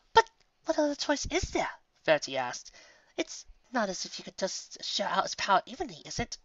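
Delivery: a hint of a doubtful pony; Verity. Verity voiced in story.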